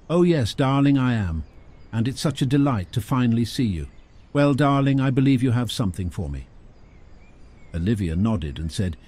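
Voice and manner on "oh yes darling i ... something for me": grand, higher lady voice; a lightly voiced gushing queenly lady. Clara gushes at Olivia.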